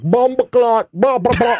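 high Jamaican patois imitation; Jamaican accent. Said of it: "all they wanted to hear was".

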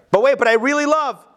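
high, pleading whine of someone who insists on loving; protesting lover. Retold or imitated. Teacher voicing an over-eager lover, high whiny protest. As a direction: protest like a hurt child who only wants to give. Child protesting it loves too much.

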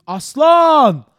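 loud, high yell from the window; shouted command. Retold or imitated. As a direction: bellow the dog's name to stop him.

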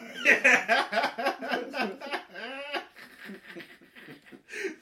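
long high laugh; loud cackling laugh. Real, not acted. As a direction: cackle at your own joke. Long loud laugh at own joke.

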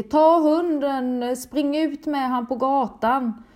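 loud, high, slow desperate yell; frantic shout. Retold or imitated. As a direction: yell at the kids to grab the dog and run. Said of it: "och jag skriker".

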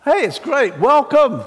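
over-the-top cheerful greeting; gushing welcome. Acted. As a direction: throw the door open with joy. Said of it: "they said to these Galatians".

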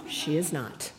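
usual speaking voice

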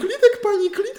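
very high squeaky plea to calm down; high-pitched mock soothing. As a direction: pipe up high, pleading for calm. Mock soothing of the furious doctor. (vysoké pisklavé uklidňování)

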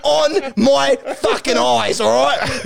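high, hammed-up demanding rant; mock threat. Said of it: "grabs Alex Rance by the collar and says".

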